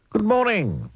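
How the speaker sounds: high, sunny greeting; cheerful Mister Happy. Acted. Mister Happy greets Mister Grumpy brightly in a high voice. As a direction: sing out a bright hello.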